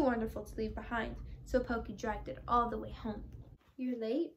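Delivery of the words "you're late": ordinary delivery, nothing marked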